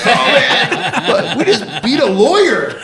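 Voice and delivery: laughing elation. Retold or imitated. Defendant and sister after beating a lawyer, laughing elation.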